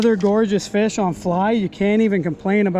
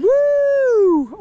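high, loud whoop of joy; excited whoop; 14 semitones higher, 3 dB louder than usual. Real, not acted. Angler whooping at his catch, big excited yell. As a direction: whoop as the fish fights.

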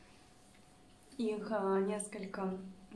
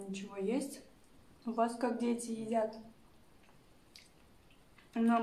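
talking between bites; eating while speaking; mouth full, chewing. Real, not acted. Seen 4: fork of food in mouth at 0-1.6, then chewing.

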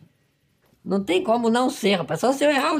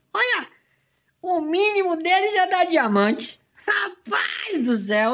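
excited disbelief; 10 semitones higher, slower than usual. Real, not acted. Shot lands on a diamond-grade animal.

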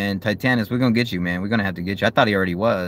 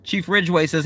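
normal talking voice

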